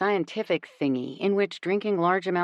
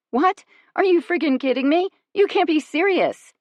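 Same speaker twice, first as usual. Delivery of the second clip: much higher and louder, incredulous outburst; outraged disbelief; 11 semitones higher, 7 dB louder than usual. A narrator's reading. To the boyfriend who denies knowing her, outraged shout. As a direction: explode in disbelief.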